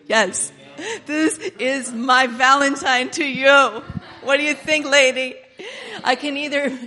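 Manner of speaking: loud, fervent preacher's cry; preaching at full force. Acted. Preacher voicing God to his flock, loud and fervent. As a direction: shout God's promise over the congregation.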